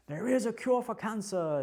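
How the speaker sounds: emphatic mock German accent; strong German voice. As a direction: declare it like a stern German trailer voice. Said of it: "the strong German voice".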